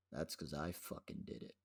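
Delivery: low, soft, deadpan reveal; quiet retold confession. Telling brother who did it, quiet mock-menacing reveal. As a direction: own up quietly and deliberately.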